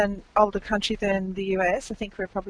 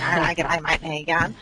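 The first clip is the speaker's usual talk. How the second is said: lightly put-on broad Australian accent, slightly lower; broad Aussie accent; 4 semitones lower than usual. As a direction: imitate a broad Aussie greeting. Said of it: "there are those that talk like this".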